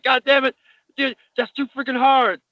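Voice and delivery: high, griping protest after a hard hit; pained complaint. Retold or imitated. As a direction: whine that it hurt too much.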